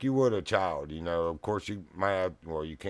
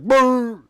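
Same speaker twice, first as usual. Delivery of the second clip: sudden very high, loud yelp; mock yelp, far higher and louder; dog yelp imitation; 17 dB louder than usual. Imitating the dog's yelp after the punch.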